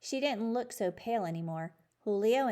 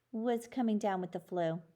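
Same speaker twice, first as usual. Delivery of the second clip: plain reading voice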